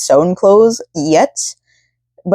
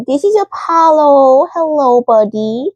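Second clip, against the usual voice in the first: high, slow, sweet voice for the dog; pet talk; 7 semitones higher, slower than usual. Real, not acted. Greeting her sick pet.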